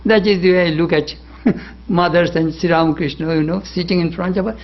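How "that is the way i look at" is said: ordinary talk, nothing marked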